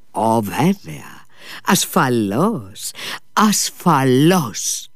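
slow, drawn-out assurance; αργόσυρτο. Acted. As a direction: stretch every word lazily. Ο Μήτια απαντά αργά, νωχελικά. (αργά, τραβηγμένα)

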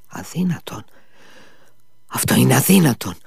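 breathy start rising into a quick protest; exclaiming. A narrator's reading. Alyosha rejects news of the incriminating document, exclaiming. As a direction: exclaim it in disbelief, first hushed, then voiced. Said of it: "αναφώνησε ο Αλιώσα".

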